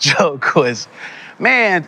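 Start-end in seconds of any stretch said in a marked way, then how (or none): 1.4-1.8 put-on American accent. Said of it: "talking in an American accent".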